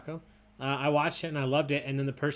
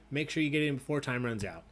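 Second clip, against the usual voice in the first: ordinary talk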